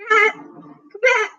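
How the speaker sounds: high, drawn-out plea to the cat; slow whiny call to a cat; slow pleading call. Real, not acted. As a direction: beg the cat slowly to return. Calling her cat back.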